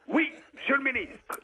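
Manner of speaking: high, clipped soldier's reply to a superior; soldier answering. Retold or imitated. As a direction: bark it up high like a para at attention. Para answers minister.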